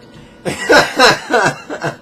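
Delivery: loud, high burst of laughter; real belly laugh, high and loud; hearty laugh. Real, not acted. Laughs at forgetting his list.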